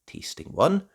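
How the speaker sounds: plain reading voice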